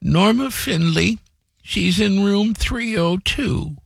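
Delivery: high, slow, frail grandmother voice; weak old voice. Retold or imitated. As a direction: speak weakly like a frail old lady. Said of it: "in her weak voice".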